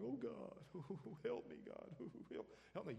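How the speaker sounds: high, whimpering, breaking into a laugh; mock a frantic plea for help; hammed-up desperate whimper; panicked baby cry to God. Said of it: "where instead of going".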